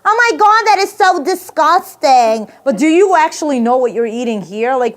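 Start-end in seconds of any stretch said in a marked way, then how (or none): 0.0-2.5 high, loud, drawn-out mock disgust; hammed-up squeal of revulsion, stretching the last word; appalled American listener. American hears the rat story, high mock disgust. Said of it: "I hear some American people".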